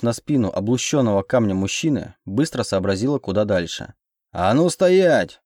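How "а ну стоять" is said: loud, high, drawn-out order to halt; guard's roared command; sudden roar. A narrator's reading. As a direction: roar it out slowly to stop them. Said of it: "Этот рык оказался столь неожиданным".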